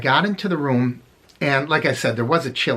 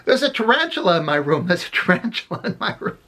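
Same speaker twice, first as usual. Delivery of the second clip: higher-pitched mock scream, no real volume; half-hearted panicky cry, pitched up; retold panicked scream; 6 semitones higher than usual. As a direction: imitate a friend's panic without really yelling. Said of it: "running down the hall screaming".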